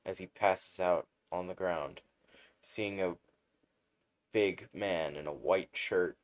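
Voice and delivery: usual speaking voice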